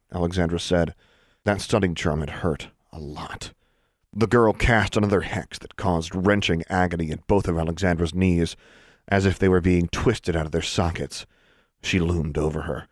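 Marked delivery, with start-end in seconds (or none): none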